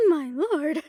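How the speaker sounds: ordinary talk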